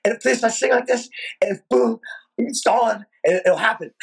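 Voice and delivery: put-on high, halting half sentences of an excited kid; Rain Man-ish babble. Breathless teenager telling his mom about the band, high babbling. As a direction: imitate yourself blurting fragments in a high voice. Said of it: "mumbling, kinda like, uh, you know, kinda Rain Man-ish".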